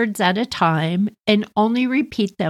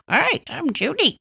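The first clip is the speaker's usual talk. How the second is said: parakeet talking; 5 semitones higher than usual. Retold or imitated. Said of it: "he full on started saying".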